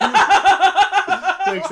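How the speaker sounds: high squeaky mock voice, laughing through it; weird put-on voice. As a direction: play a silly guest thanking Emily. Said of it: "your voice sounded so weird".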